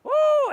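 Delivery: high, loud "woo"; loud, sustained cheer mid-class; excited whoop. Real, not acted. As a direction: let out a big high whoop. Exuberant cry amid yoga flow.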